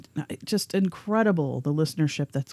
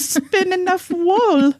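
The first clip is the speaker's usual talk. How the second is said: put-on high, pleading little girl; scared child voice; 12 semitones higher, 7 dB louder, much slower than usual. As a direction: beg Mama in terror. Frightened child in acted quote.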